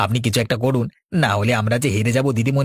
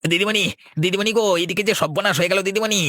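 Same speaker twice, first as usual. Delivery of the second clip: panicked alarm; 8 semitones higher than usual. Acted. Panicked news of ruin.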